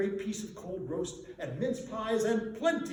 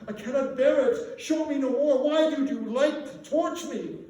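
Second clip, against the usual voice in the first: loud, much higher, desperate begging; anguished pleading; 9 semitones higher, 9 dB louder than usual. Acted. Scrooge begging the ghost to show no more, anguished. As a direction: beg the spirit to stop, near breaking.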